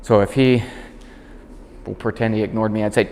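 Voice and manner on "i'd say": normal talking voice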